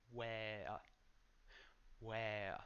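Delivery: where with W like a U sound; careful where. Shown as a demonstration. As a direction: start where with a rounded oo-like W. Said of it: "an open A and a schwa".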